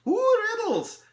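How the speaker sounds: high, loud squeal of delight; delighted elf. Acted. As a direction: pipe up with giddy relish.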